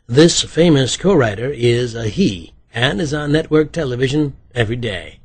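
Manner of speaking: loud, punchy quizmaster announcement; game show host. A narrator's reading. Writer gives detectives a trivia clue, game show host style. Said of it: "like a game show host".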